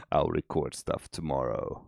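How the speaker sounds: ordinary talk, nothing marked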